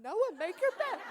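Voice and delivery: bossing her own son like a pupil; sing-song classroom command; teacher voice at home. Retold or imitated. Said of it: "still talking to the children".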